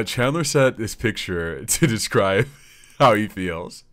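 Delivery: high, loud, breaking into laughter; laughing while talking. Real, not acted. Host introduces a funny picture, laughing. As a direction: laugh through the words.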